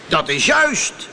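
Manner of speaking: high, emphatic bellow; roaring genie. Acted. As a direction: roar the confirmation as an angry spirit. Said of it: "brulde de geest". (brullende geest)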